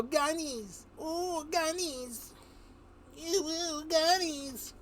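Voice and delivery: high, gleeful cooing over the guns; giddy crazies. Acted. Inmates drooling over the weapons, high squeals. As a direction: squeal like a lunatic finding toys. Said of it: "they would go".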